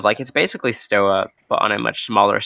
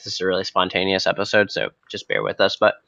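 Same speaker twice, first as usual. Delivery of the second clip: usual speaking voice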